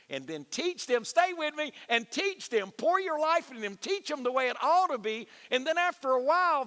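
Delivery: high, loud preacher's climax, every phrase pushed out; preaching at full force. Acted. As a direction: drive it home like a revival preacher.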